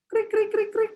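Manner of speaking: cricket chirps. Acted. Awkward silence, cricket noise.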